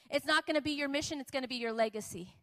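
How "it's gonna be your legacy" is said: ordinary delivery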